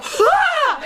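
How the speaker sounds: high shriek of fright; startled shriek. Retold or imitated. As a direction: shriek like a mouse ran up you. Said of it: "we both go".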